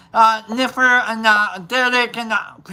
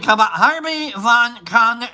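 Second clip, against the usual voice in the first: ordinary talk, nothing marked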